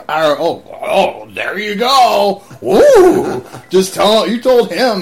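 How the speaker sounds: high, loud sarcastic jeer, slow at first; mocking taunt. Airman taunting the NCO who yelled at his guy, high mocking jeer. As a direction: taunt him for yelling at your buddy.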